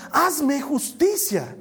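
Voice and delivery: high, drawn-out cry for justice; acted wailing plea, slowly; tearful plea. Anna begging the Lord for justice, tearful plea. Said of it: "lloraba delante del Señor y le decía".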